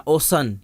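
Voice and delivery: اوسن with S for the C; wrong S reading. Shown as a demonstration. Teacher asks why not read it so, S sound. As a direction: say اوسن as OH-sun. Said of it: "کیوں نہیں پڑھ رہے".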